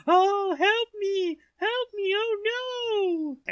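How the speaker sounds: very high, loud, slow wailing cries of a damsel; woman screaming for help. Acted. Illusion of a damsel in distress luring the giant, high screaming cries. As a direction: shriek it like a terrified woman in distress. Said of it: "the scream of a woman going".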